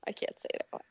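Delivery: whispered aside; whispering. Real, not acted. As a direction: whisper it like a secret. Keeping a secret from listeners.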